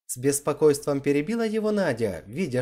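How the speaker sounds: plain reading voice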